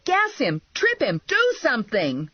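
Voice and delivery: high-pitched string of frantic commands; urgent yelling. A narrator's reading. Bounty hunter to useless partner as fugitive escapes, frantic yelling. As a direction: fire off orders in rising desperation. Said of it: "I yelled to Clown".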